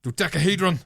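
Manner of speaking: loud, high threatening bark; threatening insult. Shown as a demonstration. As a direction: hurl the word as a menacing insult. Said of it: "Should be more threatening".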